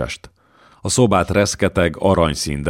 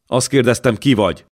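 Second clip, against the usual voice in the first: a touch of threat, pitched up; menacing tone; 6 semitones higher, 3 dB louder than usual. A narrator's reading. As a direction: warrior repeats his demand to the intruder, mildly menacing. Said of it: "ismételte vészjós hangon".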